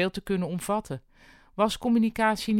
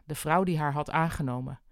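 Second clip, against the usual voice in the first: plain reading voice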